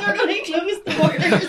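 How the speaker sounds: high, laughing panicked quote. Retold or imitated. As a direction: squeal it through laughter. Fleeing Belfast in panic.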